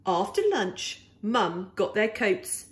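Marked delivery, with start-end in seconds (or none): none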